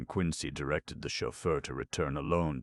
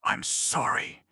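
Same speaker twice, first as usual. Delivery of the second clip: gentle; 9 semitones higher, 5 dB louder than usual. Acted. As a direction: say it gently, with regret. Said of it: "said Green gently".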